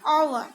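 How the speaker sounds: आवर said with no h, like "our"; silent h. Shown as a demonstration. Teacher contrasting आवर with the wrong हावर. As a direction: start आवर straight on the vowel. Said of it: "इसे हावर read नहीं किया जाता".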